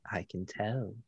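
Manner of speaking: knowing whisper. Acted. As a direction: breathe it knowingly. DM voices NPC knowingly.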